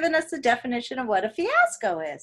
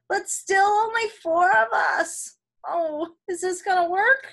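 shaky anxious character voice; anxious pleading; 7 semitones higher than usual. Acted. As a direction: beg with rising worry.

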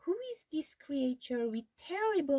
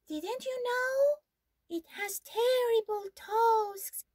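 much higher and louder, savouring terrible; high mouse voice, dramatic; mouse building a monster; 11 semitones higher, 4 dB louder than usual. Acted. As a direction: spook the fox with relish.

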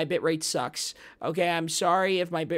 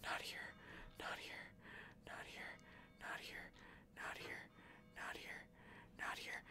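softer, unvoiced repeated whisper; whispered pleading; 25 dB quieter than usual. Real, not acted. Streamer begging the game not to fall, whispered. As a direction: beg quietly under your breath.

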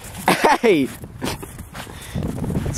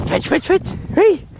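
high, eager urging; excited dog talk; 12 semitones higher than usual. Real, not acted. Owner egging her dog on to fetch, high and eager. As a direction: pump the dog up to grab the stick.

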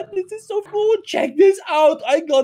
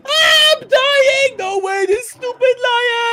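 panicked yell; 6 semitones higher, 4 dB louder, slower than usual. Acted. Lion attack continues.

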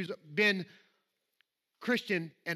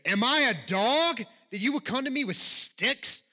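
slow, higher, louder mocking challenge; taunting giant; 5 semitones higher, 4 dB louder than usual. Preacher reading Goliath taunting David, slow loud mocking voice. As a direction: sneer it down at the boy like a scornful giant.

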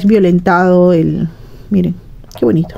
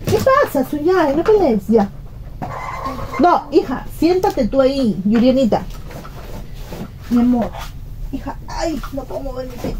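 much higher and softer, gentle mom voice; hushed mother settling daughters who squabble; 5 semitones higher, 7 dB quieter than usual. Real, not acted.

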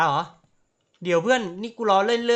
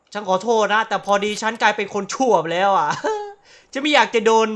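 louder, giggling through the words; laughing; 3 semitones higher, 7 dB louder than usual. Real, not acted. Gamer jokes about becoming a villain, laughing through it. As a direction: laugh while confessing.

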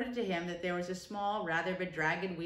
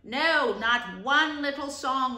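louder, slow firm refusal; 5 semitones higher, 8 dB louder, much slower than usual. Acted. Rat refuses Toad's plea, firm. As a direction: shut it down without cruelty. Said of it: "replied the rat firmly".

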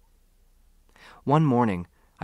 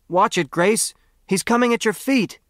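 much higher, louder frantic warning; urgent warning shout; 10 semitones higher, 4 dB louder than usual. A narrator's reading. Harold shouts a warning to Grace as the weasel attacks, high and frantic. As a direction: yell at her to get out of the way.

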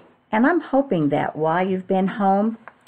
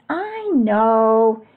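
high, stretched-out "I know"; crying out; 5 semitones higher, 3 dB louder than usual. A narrator's reading. Froggy answers father about the baking powder, crying out. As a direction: draw it out high, a child's cry. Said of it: "cried Froggy".

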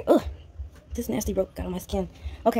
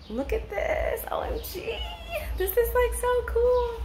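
laughing excitement. Real, not acted. Laughs, squeals at view.

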